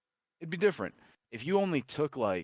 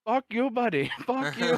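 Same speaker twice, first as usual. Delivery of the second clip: high, louder outburst of fury; pissed off; 6 dB louder than usual. Retold or imitated. Scammer cursing out the prankster, pissed off. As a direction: blow up in a high-pitched mock rage. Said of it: "just a fucking pissed off man".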